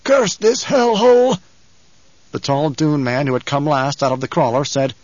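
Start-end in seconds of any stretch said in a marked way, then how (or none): 0.1-1.4 high, slow, strained curse; gasped curse. A narrator's reading. Coughing rescued man cursing the desert, gasped. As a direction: curse it out slowly, pitched way up. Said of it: "Presently he gasped".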